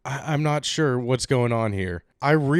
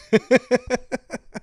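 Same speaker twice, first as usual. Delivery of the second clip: high burst of laughter; 12 semitones higher, 4 dB louder than usual. Real, not acted. Laughing at Yahoo line.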